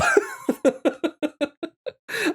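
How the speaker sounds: high, giggly laughing fit; high-pitched chuckle at your own remark. Real, not acted. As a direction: burst out giggling.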